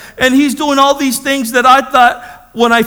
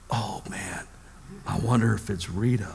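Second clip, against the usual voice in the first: very low, whispered aside; hushed dread; 13 semitones lower, 15 dB quieter than usual. Retold or imitated. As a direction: mutter it warily as the phone rings. Dreading the ringing phone.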